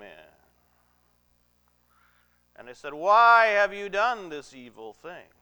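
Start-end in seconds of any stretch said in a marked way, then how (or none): 2.9-5.3 loud, raised cry of accusation; stern accusation. Retold or imitated. Army accusing Joseph's brothers of evil, stern and loud. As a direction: confront them in a retold rebuke, loudly.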